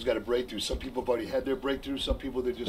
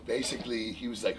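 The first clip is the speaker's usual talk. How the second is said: ordinary delivery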